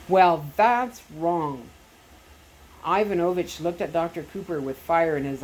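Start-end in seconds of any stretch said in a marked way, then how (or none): 0.1-1.7 slow, firm and a bit louder; slow defiant retort. Acted. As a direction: lay it down slowly, no give. Jay defies the Soviet agent.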